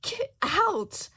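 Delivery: high, loud furious shriek; queen shrieking at the Duchess. A narrator's reading. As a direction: scream it in a rage. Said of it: "the queen shrieks".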